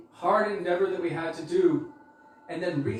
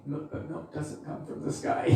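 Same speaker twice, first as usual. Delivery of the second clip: inner thought; 8 dB quieter than usual. Retold or imitated. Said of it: "And Sudevi was thinking".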